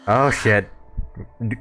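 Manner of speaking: usual speaking voice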